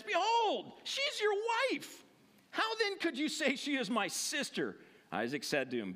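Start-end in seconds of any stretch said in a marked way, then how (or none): 0.0-4.8 indignant confrontation. Acted. Abimelech calls Isaac in to confront him, indignant. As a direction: confront him in a raised pitch.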